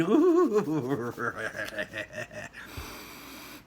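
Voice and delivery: long gleeful cackle; delighted cackle. Acted. Wonka delighted by his oven, long cackle. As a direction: cackle with glee over the oven. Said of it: "cackling with delight".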